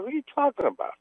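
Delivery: retold bafflement. Baffled player answers the guys, high retold voice. Acting out own bafflement in story.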